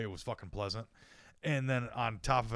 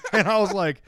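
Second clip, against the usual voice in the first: laughing; 10 semitones higher, 5 dB louder than usual. Real, not acted. Laughing while retelling.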